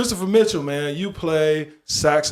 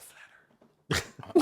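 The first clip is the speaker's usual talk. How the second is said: hushed low; 12 dB quieter than usual. Retold or imitated. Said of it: "You say flat Earth like that".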